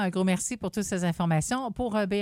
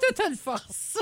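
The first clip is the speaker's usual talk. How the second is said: laughing; 11 semitones higher, much slower than usual. Real, not acted. Laughing while joking about strength.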